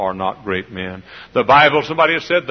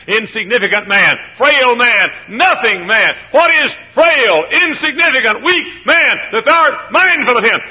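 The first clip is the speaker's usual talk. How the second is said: higher and louder, building to a cry; preaching crescendo; 13 semitones higher, 10 dB louder than usual. Acted. Preacher drives home man's frailty, building to full force. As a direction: hammer each word of the verse.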